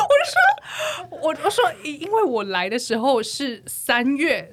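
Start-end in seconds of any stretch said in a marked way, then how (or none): 0.1-0.4 shrieking, crying laughter; helpless high laughter. Real, not acted. Friends' joke about the taxi driver sets her off, howling laughter. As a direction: laugh till it hurts.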